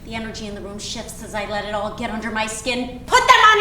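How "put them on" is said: snapped order. Acted. Mother threatening daughter with no play date, snapped order. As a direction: start high and loud, then rush the threat.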